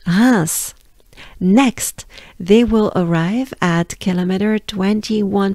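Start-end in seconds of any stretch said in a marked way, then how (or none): 0.1-0.7 Reims with the nasal vowel; French Reims. Shown as a demonstration. Teacher summing up how Reims sounds. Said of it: "there you have it".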